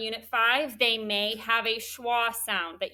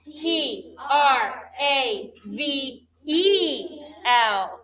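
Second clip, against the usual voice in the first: slow, even letter-by-letter spelling; spelled letter by letter; 2 semitones higher, 3 dB louder, much slower than usual. Shown as a demonstration. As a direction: call out each letter slowly for the class to join. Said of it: "Let's all spell travel together".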